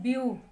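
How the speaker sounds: View set apart as the stressed syllable; view said firmly with a falling tune. Shown as a demonstration. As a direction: lean on view, the first syllable. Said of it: "हम stress कहाँ पे दे रहे हैं".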